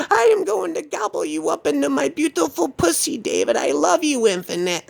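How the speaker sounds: very high crazed pursuer; Sarah. Retold or imitated. Woman chasing David up the block, high crazed voice. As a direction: chant it in a piercingly high put-on voice. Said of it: "She was chasing me up the block saying".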